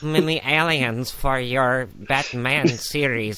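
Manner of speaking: slow, put-on artist voice; H R Giger. Co-host playing artist offering aliens, slow put-on voice. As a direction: drag it out word by word in character. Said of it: "H R Geiger".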